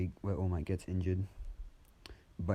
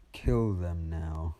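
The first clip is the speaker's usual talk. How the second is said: cold command; 4 dB louder, much slower than usual. Acted. Villain ordering skeletons to attack the gods, slow loud order. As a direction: send the army in with one slow command.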